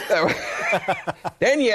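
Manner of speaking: laughing through the words; giggling between words. Real, not acted. Cracking up at own joke.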